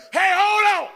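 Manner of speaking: high, loud shout; calling out. Acted. The boy shouts about the naked emperor, loud. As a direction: cry out to stop the parade.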